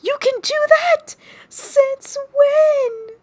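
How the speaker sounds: high, loud astonished squeal; amazed. Retold or imitated. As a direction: gasp it out in disbelief. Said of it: "And I'm like".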